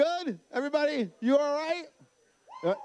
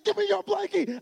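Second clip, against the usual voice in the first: high, loud acted-out demand; mad dad; 12 semitones higher, 5 dB louder than usual. Dad confiscates daughter's blankie, retold angry command. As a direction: lose your temper in comic retelling. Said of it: "I got so mad".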